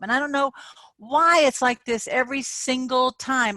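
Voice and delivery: high, loud, exasperated complaining; acted frustration. Coach acting out frustration for viewers, high and loud. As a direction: keep the fed-up rant going. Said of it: "I'm acting".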